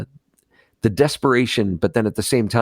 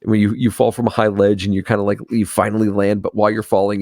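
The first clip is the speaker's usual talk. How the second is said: ordinary delivery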